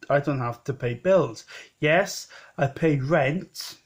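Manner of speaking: ordinary talk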